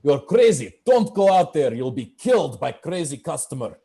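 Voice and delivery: alarmed chef in broken English, loud and high; head chef. Acted. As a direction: bellow a warning like an agitated chef. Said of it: "the head chef is just like".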